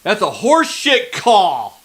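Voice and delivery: loud, high, furious shouting at the refs; furious yelling. Real, not acted. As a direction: yell it out, outraged at a bad call. Fan furious at referee's flag.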